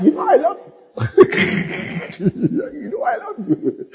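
high, mocking imitation of dad mumbling it; hammed-up mimic of father. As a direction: parody the father's offhand love line. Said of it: "It's like an utterance".

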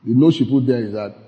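normal talking voice

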